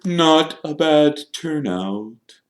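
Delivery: slow, high, self-satisfied ghost; proud Nearly Headless Nick. A narrator's reading. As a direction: remark grandly, stretching the words. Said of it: "Nearly Headless Nick proudly".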